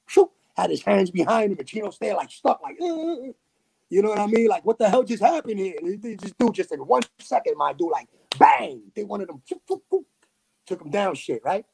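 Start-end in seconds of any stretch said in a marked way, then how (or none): 2.8-3.3 high stunned uh-uh-uh, quieter; imitating a man frozen in shock; stunned stammer. Retelling Chino frozen after takedown, stunned stammer. 9.5-10.1 high rapid shoot shoot shoot; quick mock sound effect. Telling how fast the cop took Chino down, quick high sound effect.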